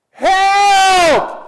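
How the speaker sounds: high, loud desperate yell; yelled help. Retold or imitated. Habakkuk yelling to God for help, loud cry. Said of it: "it means he yelled".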